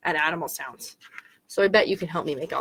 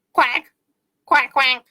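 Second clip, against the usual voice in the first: punchy quacks, a bit raised; duck quack; 4 semitones higher, 3 dB louder, slower than usual. Pretend. Answering what ducks say for children, playful quacking. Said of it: "What do they say?".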